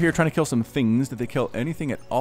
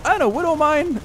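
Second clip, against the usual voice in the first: surprised. Real, not acted. Surprise Widow Mine appears.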